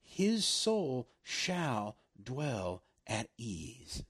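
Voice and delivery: very slow, each word weighed and spaced out; slow, let it sink in. Shown as a demonstration. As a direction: drag out His soul shall dwell at ease so every word lands. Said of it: "Let those six words really sink in".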